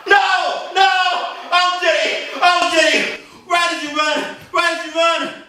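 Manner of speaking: hammed-up horror over the blood; anguished father. Master in anguish over daughter.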